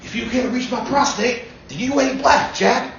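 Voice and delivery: high, folksy Biden voice; Biden impression. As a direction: do Biden as an old folksy uncle. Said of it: "they asked Biden".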